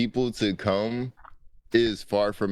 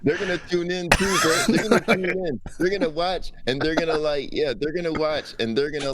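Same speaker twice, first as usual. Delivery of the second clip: excited insistence; 8 semitones higher than usual. Real, not acted. Assuring cohost fans will watch, excited and laughing.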